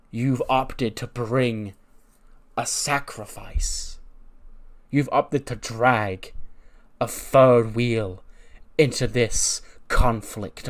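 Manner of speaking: drawn-out words, each one weighed; slow it right down to make them land; slow dramatic promo. Acted. Wrestler's promo to rivals, slow and dramatic.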